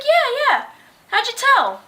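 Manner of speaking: put-on breathless girl. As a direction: play a winded fan answering. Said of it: "she was also out of breath and heavily breathing".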